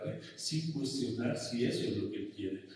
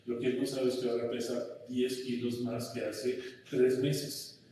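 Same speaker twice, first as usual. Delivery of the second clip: normal talking voice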